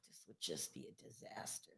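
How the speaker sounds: barely audible, breath-only murmur; whispered dread. Real, not acted. Host dreading a possible release, whispered dread. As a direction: whisper it under your breath, low.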